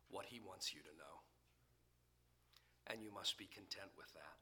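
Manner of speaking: soft, hushed and grave; solemn whisper. Acted. Preacher closing his point to the church, whispered. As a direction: whisper it like a vow.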